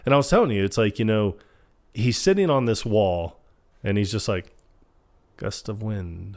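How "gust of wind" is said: soft, slow deadpan; low-energy, matter-of-fact murmur; bored prediction. Retold or imitated. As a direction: imitate calling events quietly before they happen. Imitates Phil predicting events, bored.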